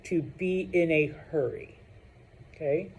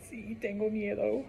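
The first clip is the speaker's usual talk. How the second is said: timid scared voice; 6 semitones higher, 9 dB quieter than usual. Retold or imitated. Scared student reply.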